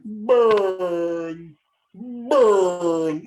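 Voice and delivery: BANG stretched out very long and loud; slow motion. Retold or imitated. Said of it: "You were doing it really slowly".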